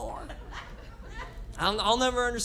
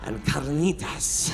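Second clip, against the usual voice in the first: mock Spanish accent; 5 semitones lower, 3 dB quieter, slower than usual. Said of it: "Why did I say it like that?".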